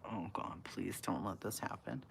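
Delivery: hushed, breathy plea under the breath; whispered prayer. Retold or imitated. Kid in the back whispering a prayer against the fight. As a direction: pray quietly no one hears. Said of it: "I'm in the back going".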